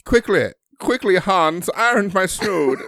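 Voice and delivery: high, loud hurried command; hammed-up old-timey order; mock frantic aristocrat. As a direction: urge the servant in a fuss. Period-drama parody continues.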